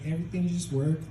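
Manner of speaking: put-on Mexican-accented dad, rising at the end; dad's Mexican accent. Immigrant dad advising his son, Mexican accent. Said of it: "my dad totally sounds like that".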